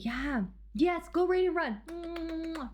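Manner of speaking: ordinary delivery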